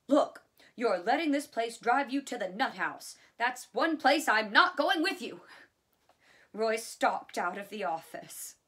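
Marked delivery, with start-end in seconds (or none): 0.1-5.4 loud, high-pitched exasperated outburst; angry snapping. A narrator's reading. Roy snapping at his brother after a heated argument, angry. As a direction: snap and build to a shout. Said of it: "Roy snapped".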